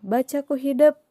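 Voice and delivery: "Baca ku hidep." ordered high and loud, stress on "hidep"; command tone. Shown as a demonstration. Teacher demonstrating a command sentence, high and loud. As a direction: tell them firmly to read. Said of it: "dina intonasina oge pasti beda".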